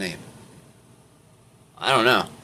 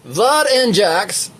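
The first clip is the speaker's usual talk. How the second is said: slow, stretched impression attempt; Leslie; 10 semitones higher, 4 dB louder, much slower than usual. Reader hunting for the right voice, slow put-on voice. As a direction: draw out each name in character. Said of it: "what my voice sounds like when I pretend to be".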